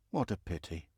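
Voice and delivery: dry, hushed sarcasm; mock pity. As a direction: pretend to be sorry, dripping with irony. Narrator mocks cut-off father.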